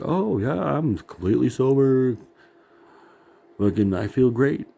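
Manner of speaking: high, swooping fake enthusiasm; mock cheer. Drinker claiming an overnight cure, mock cheerful. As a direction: brag breezily, not meaning a word. Imagined claim of quick recovery.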